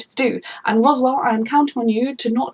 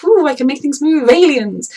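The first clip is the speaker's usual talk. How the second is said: high, loud mock-mystical boast; mock spooky boast; 6 semitones higher, 7 dB louder than usual. To a stranger, mocking a showy reveal, hammed-up. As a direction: ham it up like a showy alien.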